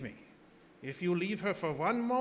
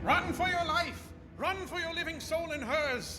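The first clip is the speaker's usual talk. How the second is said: much higher, shouted warning; urgent shout; 12 semitones higher than usual. Acted. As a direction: yell to save him. Doctor warns of vampire.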